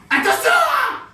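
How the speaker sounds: very high, shouted mock warning; too-high shout. Comedian overdoes a character's warning, far too high and shouted. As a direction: push the warning far up into a piercingly high yell. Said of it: "c'est trop aigu".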